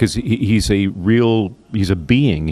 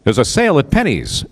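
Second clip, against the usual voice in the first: higher, hammed-up radio ad read; mock radio announcer; 5 semitones higher than usual. Shown as a demonstration. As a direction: sell it like an old radio announcer. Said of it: "you don't do this radio voice".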